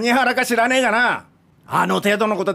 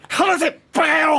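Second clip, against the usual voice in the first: high, struggling yell; furious shout while restrained; raging shout; 8 semitones higher than usual. Acted. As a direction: fight off the hands holding him.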